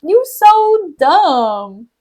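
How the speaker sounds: high, drawn-out comic scolding; hammed-up indignant wife; mock exasperation. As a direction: wail the insults, stretching the words. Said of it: "Amelia's just like".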